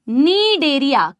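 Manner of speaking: नीडेरिया said slowly with a silent C, starting on N; careful pronunciation. Shown as a demonstration. Teacher to students, slow correct pronunciation. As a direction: draw out नीडेरिया gently, no K sound. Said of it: "कनेडेरिया नहीं है guys".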